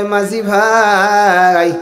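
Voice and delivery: drawn-out call to the boatman; sing-song call. Acted. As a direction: hail him slowly with a long final vowel. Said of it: "ডাক দিয়া কয়".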